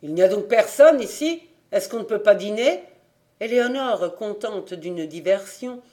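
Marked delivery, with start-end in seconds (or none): 0.0-2.8 loud, impatient call from the next room; hungry customer shouting through the doorway for his dinner. A narrator's reading. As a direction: yell for service as if from another room. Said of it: "cria dans la pièce voisine".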